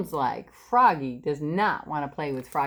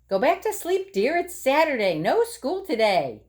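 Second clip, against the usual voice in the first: mother voice; 7 semitones higher than usual. Acted. Mother answers Froggy.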